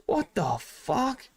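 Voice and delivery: slow, high, drawn-out disbelief; stunned what the fuck. Retold or imitated. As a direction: stretch it out in shocked disbelief. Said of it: "I was like".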